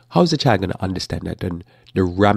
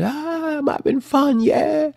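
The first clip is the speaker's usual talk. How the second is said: put-on very high, gleeful kid voice; excited child; slower than usual. Child running full speed and having fun, high and carefree. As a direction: imitate a child squealing with fun. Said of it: "the child is running full speed ahead".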